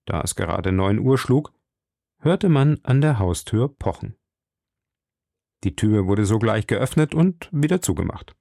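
ordinary delivery, nothing marked

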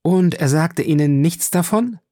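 high, incredulous cry; exclaimed question. A narrator's reading. Julie questions the count about his friend's secrets, exclaiming. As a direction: call out the question, pitched well up. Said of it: "rief Julie".

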